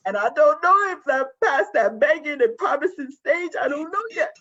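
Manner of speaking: real tears welling up as she admits the fear; voice breaking. Real, not acted. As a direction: voice cracking with tears.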